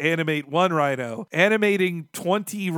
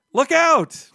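loud, high shout of warning; 11 semitones higher, 5 dB louder than usual. Pretend. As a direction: yell it like a warning. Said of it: "I can just say that".

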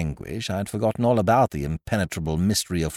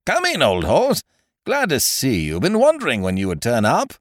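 boisterous chum, higher and louder; hearty host; 7 semitones higher, 5 dB louder than usual. Acted. Ukridge welcomes the narrator from his bed, hearty. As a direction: welcome an old pal with gusto.

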